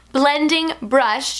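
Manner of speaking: slow, emphatic fix of "Blending brush"; careful correction. Real, not acted. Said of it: "I mixed up the words".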